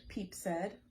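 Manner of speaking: usual speaking voice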